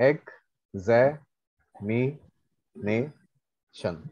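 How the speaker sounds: very slow, long gaps between syllables. Shown as a demonstration. Teacher helping class count syllables, very slow syllable-by-syllable. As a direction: break examination into separate slow beats. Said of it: "কেইটা syllable আছে".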